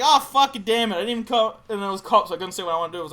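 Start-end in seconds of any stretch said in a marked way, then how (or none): 0.0-1.6 frustrated outburst. Retold or imitated. Frustration at missed car meet.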